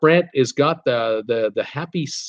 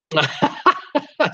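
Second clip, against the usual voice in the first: high giggle; 10 semitones higher than usual. Real, not acted. Laughs at misread comment.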